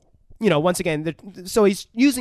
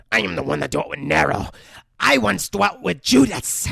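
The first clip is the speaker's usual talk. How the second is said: changing demon voices; 2 semitones higher than usual. Acted. Possessed girl naming who she dwelt with, demon voice. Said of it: "she's changing voices throughout this".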